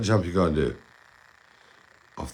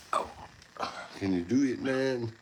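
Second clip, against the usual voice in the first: ordinary delivery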